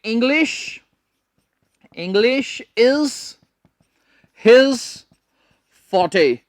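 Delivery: words spaced very slowly; slow example sentence. Shown as a demonstration. Teacher slowly building an example sentence. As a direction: draw out each word. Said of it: "हम एक sentence ले लेते हैं".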